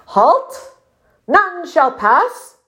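high, commanding sentry's cry; shouted challenge. A narrator's reading. Small puffin blocking the river's edge, shouting. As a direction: order them to stop, stretching the words.

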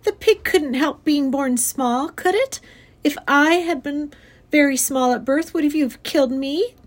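put-on high, pleading child's argument; crying out in protest. A narrator's reading. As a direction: argue urgently as a little girl. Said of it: "cried Fern".

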